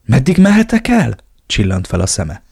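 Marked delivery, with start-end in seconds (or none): 0.1-1.2 bright, eager question; eyes lighting up, ask eagerly; lightly eager high question. A narrator's reading. Excited question from Elena.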